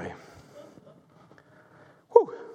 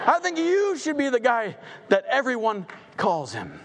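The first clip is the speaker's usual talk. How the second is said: commanding king; 6 semitones higher than usual. Acted. King orders Haman, voiced higher.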